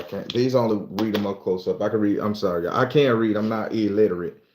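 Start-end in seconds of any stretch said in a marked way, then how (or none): none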